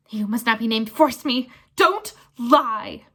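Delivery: loud bellow. Acted. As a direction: bellow the accusation.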